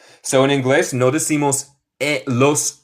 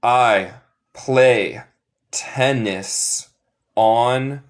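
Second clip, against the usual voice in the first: each word slow and apart for students to repeat; much slower than usual. Shown as a demonstration. Teacher drilling the class, slow and word by word. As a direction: spell out the sentence slowly for the class to copy. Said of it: "Repite después de mí para mejorar".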